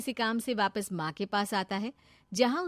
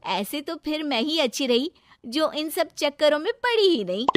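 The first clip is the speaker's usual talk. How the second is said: lightly voiced, higher, amused girl; amused young woman character; 7 semitones higher, 5 dB louder than usual. Shikha hears of his broken romance, amused. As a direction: react brightly, pitched a bit up.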